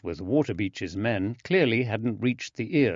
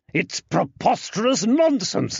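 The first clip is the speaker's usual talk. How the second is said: indignant; 12 semitones higher, 4 dB louder than usual. Acted. Tobias denies angrily.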